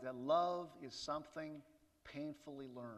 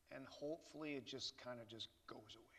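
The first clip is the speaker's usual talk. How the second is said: whisper; 5 semitones lower, 12 dB quieter, faster than usual. Real, not acted. Wishful aside, trailing off.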